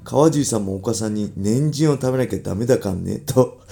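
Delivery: reading a letter aloud. Acted. Radio host reads listener's question on Ibaraki dialect, letter read-out.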